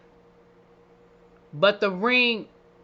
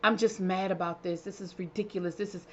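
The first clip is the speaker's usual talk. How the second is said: imitating a much softer, grumbling sulk; sulky mad; 10 dB quieter than usual. Retelling Telly's mock-mad grumble before flinging a glass, much softer. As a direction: mutter it like a pouting guy about to throw a glass. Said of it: "Telly was like".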